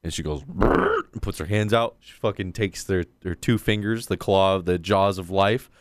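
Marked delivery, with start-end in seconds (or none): 0.4-1.0 high strangled choking gasp; mock choking gurgle, pitched up high; choking noise. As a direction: squeeze out a high choked sound.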